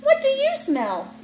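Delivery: put-on high, bouncy question to kid; high playful question. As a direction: sing it up like a game. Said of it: "Try to guess".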